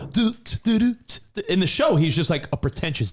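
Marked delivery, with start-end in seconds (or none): 0.1-1.5 high, goofy humming; goofy nerd. Retold or imitated. Co-host mocking TV-show Carlton's goofy dance, high nerdy humming. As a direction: doot it like a dorky Carlton. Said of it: "the goofy, nerdy".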